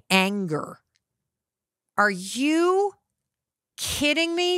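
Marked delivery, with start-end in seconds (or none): 2.0-4.6 put-on high, incredulous snap from the teen; a touch of a furious daughter, pitched up; angry daughter. Angry daughter retorts in the car.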